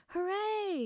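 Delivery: high, joyful hooray; cheerful shout. A narrator's reading. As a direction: cheer up at the sky. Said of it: "you shouted".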